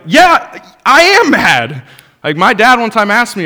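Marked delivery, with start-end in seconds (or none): 0.1-1.8 loud, high-pitched outburst of mock anger; heated and mad. Preacher playing the bitter older brother, loud and heated. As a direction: blurt it out like an angry older brother. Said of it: "I can get pretty heated".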